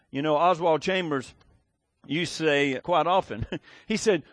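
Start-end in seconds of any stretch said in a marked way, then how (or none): none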